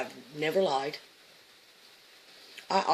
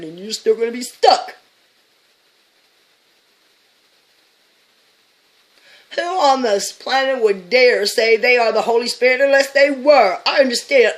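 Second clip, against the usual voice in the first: loud, high, heated; loud high-pitched rant; ranting shout; 8 semitones higher, 15 dB louder than usual. Real, not acted. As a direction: yell it at the doubters. Ranting at critics.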